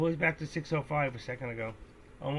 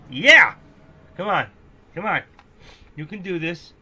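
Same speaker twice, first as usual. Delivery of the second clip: loud, higher cheer; root for the stock like a fan; cheering yell; 5 semitones higher, 15 dB louder than usual. Real, not acted. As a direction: trader yelling at the chart.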